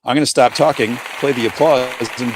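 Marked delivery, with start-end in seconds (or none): none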